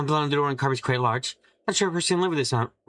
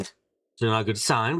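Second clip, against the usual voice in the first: normal talking voice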